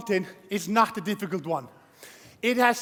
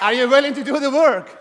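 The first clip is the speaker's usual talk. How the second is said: louder, rallying the crowd; pumped-up shout; 3 semitones higher, 6 dB louder than usual. Real, not acted. Speaker to audience, hyping them up for a loud yes. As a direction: demand a bigger answer from the room. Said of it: "You don't seem enthusiastic".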